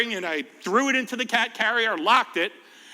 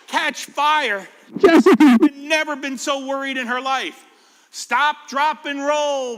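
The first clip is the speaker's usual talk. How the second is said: higher, louder storybook read; reading to children; 5 semitones higher, 6 dB louder, slower than usual. Acted. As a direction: read it out brightly to the kids. Storybook narration with character lines.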